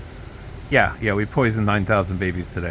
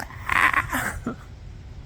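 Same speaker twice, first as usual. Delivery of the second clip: laugh; 5 dB quieter than usual. Real, not acted. Laughs after his impression.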